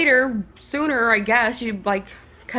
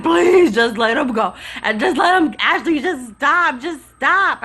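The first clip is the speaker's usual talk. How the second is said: imitated pleading friend; 3 semitones higher, 4 dB louder than usual. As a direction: beg a friend to stop, voice jumping up. Friend begging her to stop.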